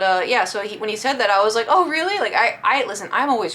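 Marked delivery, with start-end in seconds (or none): 1.7-2.2 high, loud friendly surprise; feigned surprise. Retold or imitated. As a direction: pitch it up, politely amazed. Retold friendly surprised reply.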